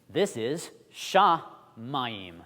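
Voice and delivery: "shamayim" drawn out very slowly, leaning on "ayim"; Hebrew dual ending. Shown as a demonstration. Teacher making the class hear the dual ending. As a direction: stretch "shamayim" so the "ayim" rings out. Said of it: "You hear that ayim sound at the end".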